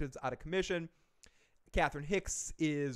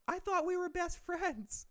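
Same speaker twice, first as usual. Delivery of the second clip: much higher, slow creepy doll voice; Chucky; slower than usual. Retold or imitated. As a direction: drag it out like a sinister doll. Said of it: "where Chucky goes".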